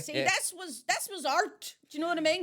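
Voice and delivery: put-on high, loud proud old-timer; hammed-up elderly music snob; mock old fan. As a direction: declare it like a nostalgic pensioner. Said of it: "and go".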